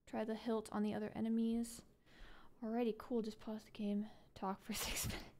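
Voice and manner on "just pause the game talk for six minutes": hushed whispered aside. Real, not acted. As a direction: whisper it off-mic. Whispered aside while stream waits.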